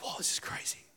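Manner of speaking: very soft, voiceless wonder; hushed, acted-out amazement; awed whisper. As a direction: whisper it like an amazed little kid. Acts child awed by driveway.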